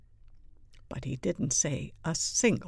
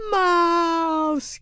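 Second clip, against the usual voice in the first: high, drawn-out alarmed yell; loud shriek of alarm; 11 semitones higher, 8 dB louder than usual. A narrator's reading. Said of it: "yelled everyone".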